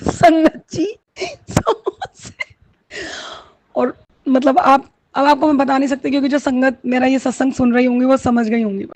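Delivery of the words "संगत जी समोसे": breathy, high and drawn out, nearly voiceless; overwhelmed amazement. Real, not acted. Devotee revealing the samosas to the congregation, overwhelmed.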